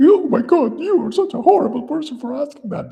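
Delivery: shrieky, very high, loud falsetto indignation; mock outrage. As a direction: imitate a scandalized objector at the top of the range. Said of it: "there'll be someone who will be asking".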